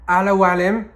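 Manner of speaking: Arabic phrase with a French accent. Real, not acted. Admitting an uncertain pronunciation to followers, French accent. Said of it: "avec mon accent, là, de français".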